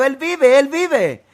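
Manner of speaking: high, loud joyful shout; excited repeated cry; shouting again. Retold or imitated. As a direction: shout it like he can't hold it in. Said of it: "él está aquí en este cuarto gritando".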